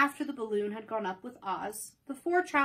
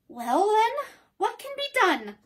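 much higher, louder question; inquiring Woodman; 10 semitones higher, 7 dB louder than usual. Acted. Woodman asking what to do, high questioning voice. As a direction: ask it high and eager. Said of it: "inquired the Woodman".

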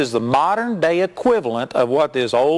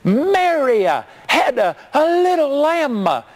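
mock preacher shout; 11 semitones higher than usual. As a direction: ham up a revival preacher howling a nursery rhyme. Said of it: "started screaming and shouting and said".

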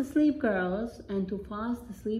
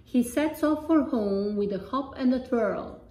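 ordinary delivery, nothing marked

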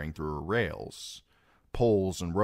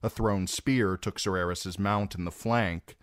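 usual speaking voice

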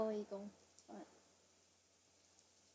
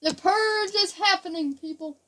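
mock dramatic announcement; 6 semitones higher, 7 dB louder than usual. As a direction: proclaim doom to the players. Mock announcement of a killing spree.

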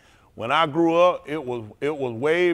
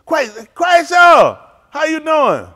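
high, slow, louder hello; excited greeting; 11 semitones higher, 9 dB louder, much slower than usual. Real, not acted. Comedian greeting viewer Quayshaw, excited. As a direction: sing out his name in delight.

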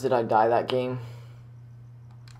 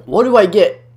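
louder, higher insistent question; emphatic; 7 semitones higher, 9 dB louder, faster than usual. Real, not acted. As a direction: demand it louder. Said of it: "repeated question louder".

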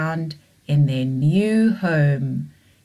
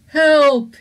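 higher, louder call from the bottom of a hole; cry for help; 9 semitones higher, 10 dB louder than usual. Acted. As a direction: shout up out of the pit. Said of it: "he said".

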